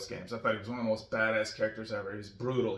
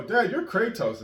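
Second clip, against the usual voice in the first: put-on higher, slow excited kid voice; imitating kids; 10 semitones higher, 7 dB louder, slower than usual. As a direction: kids teasing dad. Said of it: "so they're like".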